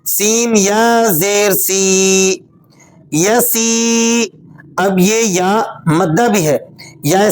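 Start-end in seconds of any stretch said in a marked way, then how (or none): 0.1-4.2 slow, drawn-out Qaida spelling of سی and یسی; spelling out the syllable. Shown as a demonstration. Teacher spelling out the word for children, slow and drawn out. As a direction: chant each letter slowly like a teacher. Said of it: "ہم سین کو یا سے ملائیں گے".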